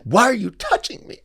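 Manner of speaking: high, loud protest, played for laughs; mock outrage. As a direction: imitate someone shrieking at being touched. Acts Eric's mock outrage at touch.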